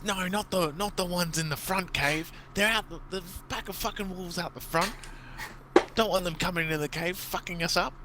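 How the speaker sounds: high, gruff impatient goblin voice; goblin boss explaining. Acted. Goblin boss snapping back at a confused goblin, high and impatient. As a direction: set him straight, irritated. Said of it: "and he says".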